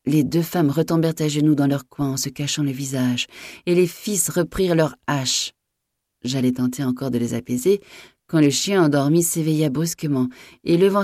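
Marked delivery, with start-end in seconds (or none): none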